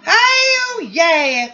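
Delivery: high-pitched, drawn-out whoop; high catchphrase whoop. Acted. Performer repeating the closing catchphrase, high whoop. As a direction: send it up high and stretch it.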